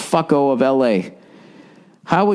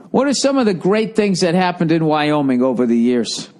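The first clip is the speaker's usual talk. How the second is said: ordinary delivery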